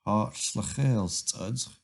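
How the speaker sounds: Hartelijk studge slow, stress on Hartelijk, rising end; teacher's last slow model of the greeting; slow greeting for repetition. Shown as a demonstration. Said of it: "repeat after me".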